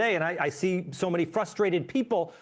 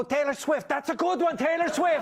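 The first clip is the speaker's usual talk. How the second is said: put-on Swedish accent; 6 semitones higher than usual. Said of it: "you were Swedish and then you went Italian".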